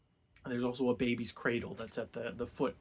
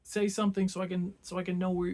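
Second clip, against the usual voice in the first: raised, higher calling out; mock eager calling; 6 semitones higher, 4 dB louder, faster than usual. Absalom calling into the dark window. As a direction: ham up an eager ask.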